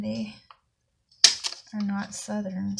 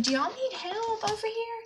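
much higher, sugary offer of help; sweet high voice; 11 semitones higher than usual. Retold or imitated.

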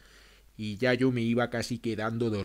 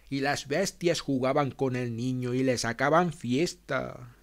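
ordinary delivery, nothing marked

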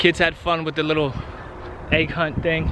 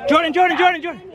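calling from far away; 12 semitones higher, faster than usual. Real, not acted. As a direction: call her name across the yard. Calling the child across yard.

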